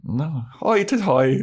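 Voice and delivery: high, hammed-up Irish lilt; exaggerated stage-Irish voice; mock Irish accent. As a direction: do a silly leprechaun Irish accent. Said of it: "you're Irish".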